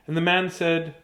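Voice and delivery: ordinary delivery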